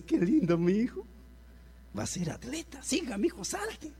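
high, soft sing-song praise, rising; a happy father cheering his son on the couch; mock doting parent. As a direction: coo over the child like a proud parent. Doting parent praises jumping child.

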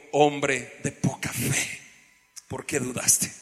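a calm, low rebuke; Jesus rebuking. Retold or imitated. Jesus lifts sinking Pedro and asks why he doubted, low and slow. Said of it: "y lo levantó y le dijo".

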